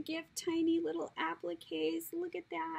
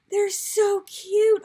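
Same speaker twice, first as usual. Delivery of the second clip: much louder, gushing over cuteness; delighted squeal; 7 semitones higher, 15 dB louder, slower than usual. Real, not acted. Seeing the tassels, loud delighted gush. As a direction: burst out with delight.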